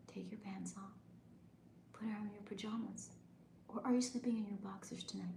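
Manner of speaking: soft, calm bedtime whisper; soothing whisper. Shown as a demonstration. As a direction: lull them toward sleep. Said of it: "a soothing, calm, relaxing one".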